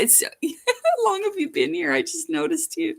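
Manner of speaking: laughing, high surprised question. Real, not acted. Vlogger noticing a friend in chat, laughing surprise. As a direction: caught off guard, delighted.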